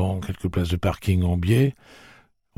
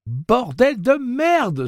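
high, loud outburst of swearing; startled high-pitched exclamation; exclaiming; 4 dB louder, much slower than usual. A narrator's reading. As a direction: cry it out slowly, pitched way up. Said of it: "s'écria Eddie".

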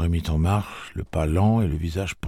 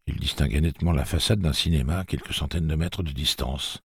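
ordinary delivery, nothing marked

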